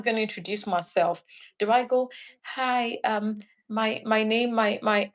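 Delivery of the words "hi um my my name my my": stuttering. Shown as a demonstration. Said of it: "and I'm stuttering".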